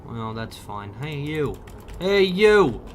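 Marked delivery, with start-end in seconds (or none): 2.0-2.8 loud, higher call of Hey, you!; shouting hey you at a stranger. Real, not acted. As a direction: yell it across at him. Calling out to game character.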